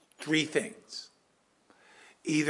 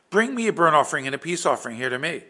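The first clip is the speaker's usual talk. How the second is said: commanding king; 3 semitones higher, 9 dB louder, much faster than usual. Acted. Saul orders the sacrifice.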